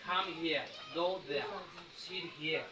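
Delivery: British accent with silent final r. Shown as a demonstration. Teacher demonstrates to students, British accent without final r. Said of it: "British accent".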